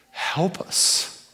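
breathy whispered plea to God; whispered prayer. Real, not acted.

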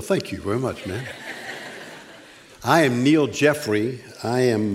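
usual speaking voice

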